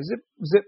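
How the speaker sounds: punchy speed sound effect; whizzing-by noise. Retold or imitated. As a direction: fire off zips like things flying past. Imitating trees whizzing past at speed.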